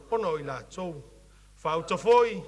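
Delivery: ordinary talk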